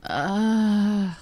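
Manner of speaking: normal talking voice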